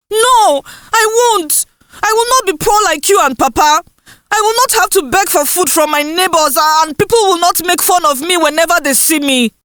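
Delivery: put-on defiant girl. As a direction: talk back to mother fiercely. Indusa defies her mother.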